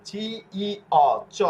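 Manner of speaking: চ sounded out as "cho" after চি, ই, অ; spelling drill. Shown as a demonstration. Teacher showing learners the ch sound, letter-by-letter spelling drill. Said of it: "চ এর উচ্চারণ করার জন্য".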